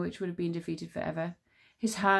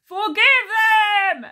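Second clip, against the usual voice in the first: high, loud, very slow booming roar; giant's roar; 13 semitones higher, 13 dB louder, much slower than usual. Acted. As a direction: bellow like a giant's dying breath. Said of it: "at a decibel so loud".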